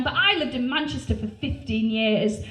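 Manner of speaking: ordinary delivery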